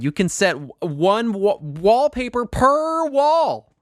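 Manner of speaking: amazed, climbing high and drawn out on the last words; excited gushing. Real, not acted. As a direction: gush in rising disbelief. Gushing over a game update.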